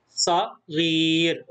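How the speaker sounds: صغير drawn out very slowly, each letter clear; teacher stretching صغير for pupils; very slow model reading. Shown as a demonstration. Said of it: "جردنا الحرف".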